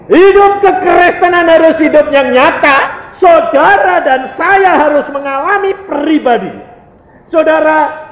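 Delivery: high, loud, declamatory preaching; khotbah berapi-api. Real, not acted. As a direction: exhort the congregation at full force. Preaching at full force. (berkhotbah lantang dengan nada tinggi)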